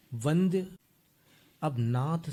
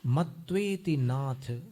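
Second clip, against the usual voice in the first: slow recitation; much slower than usual. Shown as a demonstration. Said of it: "teacher reciting verse slowly".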